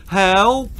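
put-on trapped boy shouting for help; shouted. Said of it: "he shouted".

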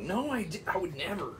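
high, softening falsetto; high defensive falsetto fading to a whisper. Acted. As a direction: protest innocently in a high voice. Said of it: "Madonna was like".

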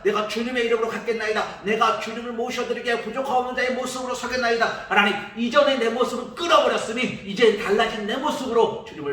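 fervent confession building in pitch; repentant prayer. Acted. Preacher voices Zacchaeus's repentance prayer. (삭개오의 회개 기도, 점점 고조되는 목소리)

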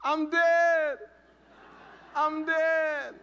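high, loud dazed shout; yelled back. Retold or imitated. Teen yelling back to the lady from the wrecked car, loud high shout. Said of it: "And I yelled back".

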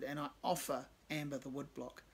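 usual speaking voice

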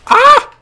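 short, very high scream; panicked scream. Real, not acted. Player caught in gunfire, panicked scream. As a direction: scream loudly under fire. Under fire in game.

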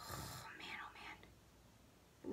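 very slow, sighing whisper; shaken whisper. Real, not acted. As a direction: whisper it on a long sigh after a scare. Recovering from her cricket scare.

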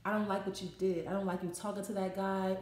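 low, soft, controlled confrontation; very calm. Acted. Upset partner calmly confronts you about that guy, very calm. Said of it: "in a very calm manner".

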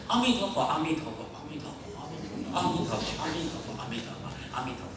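repetitive chant fading into a murmur; mock mouth recitation. Teacher mimicking people who chant only by mouth, mock rote murmur. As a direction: imitate rote chanting, trailing away softly. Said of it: "华人都很喜欢用嘴巴".

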